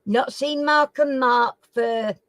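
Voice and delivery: ordinary talk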